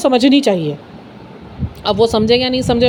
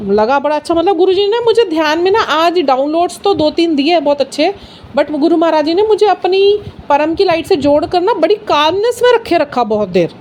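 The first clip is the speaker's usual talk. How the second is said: usual speaking voice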